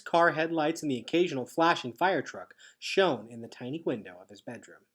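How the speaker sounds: ordinary delivery, nothing marked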